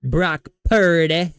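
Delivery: slow, drawn-out quote with the name stretched; mocking, deliberate imitation; Cam Newton. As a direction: stretch it out, leaning hard on the name. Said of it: "the way he says Brock Purdy".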